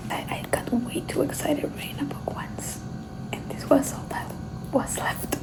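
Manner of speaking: hushed aside; soft confiding whisper. Real, not acted. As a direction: whisper it like a secret. Whispering an aside about pen.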